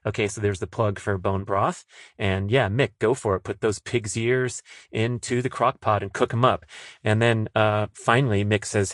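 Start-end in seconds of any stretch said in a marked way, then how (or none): none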